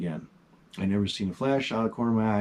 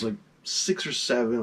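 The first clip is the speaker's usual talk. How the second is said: ordinary talk, nothing marked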